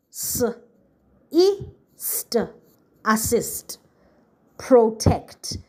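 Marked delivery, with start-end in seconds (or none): none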